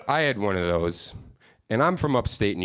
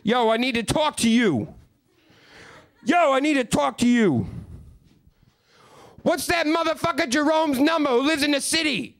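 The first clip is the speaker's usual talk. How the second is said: antsy Steve. Retold or imitated. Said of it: "Starts pacing".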